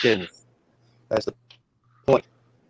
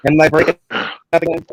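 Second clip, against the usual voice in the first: radio breaking up; 7 dB louder than usual. Real, not acted. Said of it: "You're breaking up big time".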